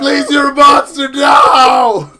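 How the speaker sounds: high, loud crying shout; hammed-up pleading wail; mock terrified plea. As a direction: scream it like a begging victim. Victim pleading in retold scene.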